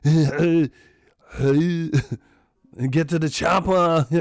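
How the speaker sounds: loud Austrian command; Arnold impression. Arnold ordering everyone to the chopper, loud. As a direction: bark it like Schwarzenegger. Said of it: "My man came from Austria".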